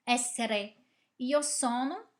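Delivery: normal talking voice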